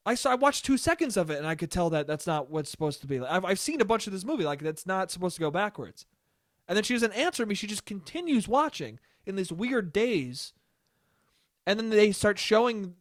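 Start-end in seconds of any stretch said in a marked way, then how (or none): none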